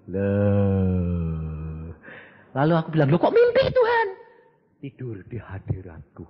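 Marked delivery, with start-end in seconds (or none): 3.1-4.1 high, startled question; surprised. Retold or imitated. Preacher asking God about the dream, high surprised. 4.8-6.2 low, soft and calm; God's soft answer. Acted. God answering the preacher, low soft calm.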